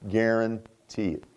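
"Guarantee it" drawn out low and soft; slow, quiet, weighty assurance; slow, hushed emphasis. Real, not acted. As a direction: drop low and stretch out "Guarantee it". Emphatic repeat, first take hushed and drawn out.

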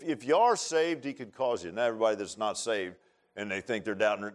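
ordinary talk, nothing marked

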